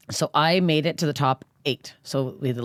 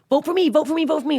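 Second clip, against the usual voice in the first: high, pleading chant of a contestant; mock campaigning plea; 13 semitones higher, 4 dB louder than usual. Comic mocking her rivals' online vote begging. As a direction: beg for votes in a silly high singsong. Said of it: "all this crazy social media".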